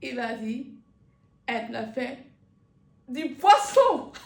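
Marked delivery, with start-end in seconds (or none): 3.1-4.3 plus haut, fort et lent, avec rire; éclate de rire sur le mot; chute en riant. Real, not acted. (chute dite dans un éclat de rire)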